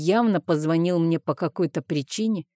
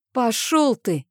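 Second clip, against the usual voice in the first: angry retort; 6 semitones higher, slower than usual. A narrator's reading. Heroine curses the villain.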